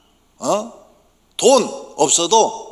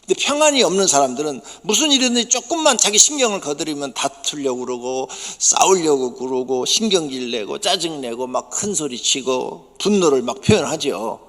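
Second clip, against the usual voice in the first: usual speaking voice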